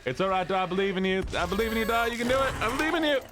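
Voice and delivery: high cheer, climbing with each phrase; rising encouragement. Real, not acted. Cheering on a teammate.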